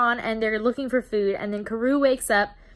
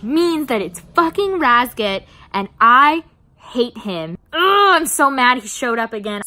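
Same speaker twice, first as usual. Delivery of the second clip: loud, hammed-up angry outburst; exasperated rant; 2 semitones higher, 7 dB louder than usual. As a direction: play it fed up, leaning hard on the words. Voices Jael ranting about Razgat.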